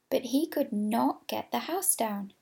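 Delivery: plain reading voice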